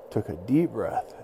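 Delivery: usual speaking voice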